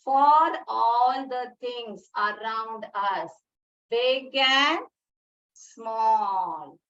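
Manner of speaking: slow, each word drawn out; slow recitation. Shown as a demonstration. Teacher modelling the poem line for young pupils, slow. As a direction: spell the line out slowly for children.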